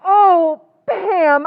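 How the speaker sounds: shocked gasp. Acted. Kathy shocked she must do it.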